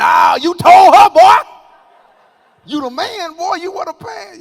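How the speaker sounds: high, loud mocking hype shout; hype-man friend. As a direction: egg him on like a bad friend. Bad friend hyping him up.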